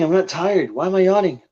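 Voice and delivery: usual speaking voice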